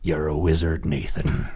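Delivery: deep gravelly growl, low and rough; low gravel vigilante growl. Pretend. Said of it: "said in a gravelly voice".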